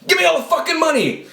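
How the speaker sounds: high-pitched imitation of his own panicked self; mock panic. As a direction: replay being startled at knifepoint, voice pitched high. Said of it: "I'm like".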